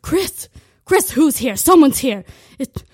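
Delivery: mom shouting that the pizza guy is here; over-the-top mom with an invented accent; frantic mom voice. Retold or imitated. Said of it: "my mom doesn't have an accent, but ... that's what the reenactment sounded like".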